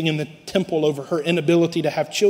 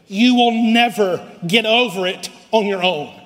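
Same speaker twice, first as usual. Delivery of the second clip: ordinary talk, nothing marked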